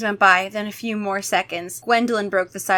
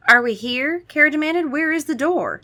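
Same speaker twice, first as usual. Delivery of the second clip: a hint of a higher insistent girl; demanding Kara; 6 semitones higher than usual. Kara demanding the exit, mildly voiced. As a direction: press for the door, lightly voiced.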